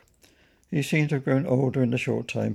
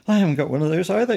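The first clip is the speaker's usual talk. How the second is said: higher, louder and quick; eager rush of words; excited; 6 semitones higher, 6 dB louder, faster than usual. A narrator's reading. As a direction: blurt it out excitedly. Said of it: "exclaimed Pennington excitedly".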